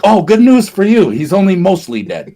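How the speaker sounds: put-on high, loud cheerful old healer; Miracle Max impression. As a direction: chirp it like a cheery quack doctor. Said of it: "and he's like".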